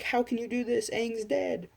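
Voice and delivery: high-pitched mock outrage from a viewer; exaggerated high voice of a complainer; mock objecting viewer. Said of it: "You are like".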